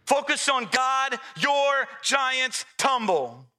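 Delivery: high, rising full-force preaching. Acted. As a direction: preach it out with building fire.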